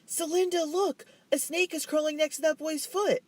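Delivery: very high, loud fake alarm; feigned horror shouting about a snake; cry of horror. A narrator's reading. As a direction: scream out a pretend warning. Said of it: "she cried out".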